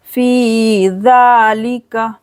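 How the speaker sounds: फ़ी ज़ालिका with long fee and zaa, short li; slow फ़ी ज़ालिका, long vowels held; stretched fee. Shown as a demonstration. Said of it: "फ़ी को खींचेंगे दो second के लिए".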